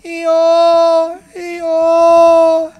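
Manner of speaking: high drawn-out bray; a long, plaintive donkey call; donkey calling from the well for an hour. Acted. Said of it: "the donkey was shouting and shouting".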